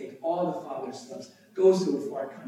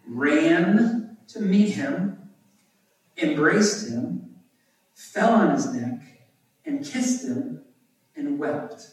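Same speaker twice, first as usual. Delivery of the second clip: slow, drawn-out RAN, each phrase set apart by pauses; emphatic verse reading; 3 semitones higher, 5 dB louder, slower than usual. A narrator's reading. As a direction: read each action slowly with weight, from "ran" to "wept".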